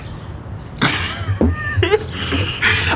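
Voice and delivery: high laugh. Real, not acted. Laughs at his mistake.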